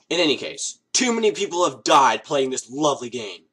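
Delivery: usual speaking voice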